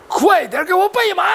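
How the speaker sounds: high, urgent call for a horse; urgent order. Acted. (急切高声下令)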